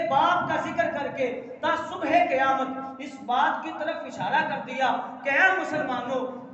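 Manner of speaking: loud, rising sermon delivery; preaching at full force. Acted. Preacher urging the congregation to honour their parents, full force. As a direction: drive it home to the faithful.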